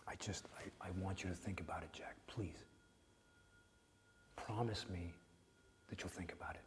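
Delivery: hushed, gentle reassurance; soft whisper. Acted. Therapist quietly reaching out to Jack, hushed whisper. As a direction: whisper it close, like comfort.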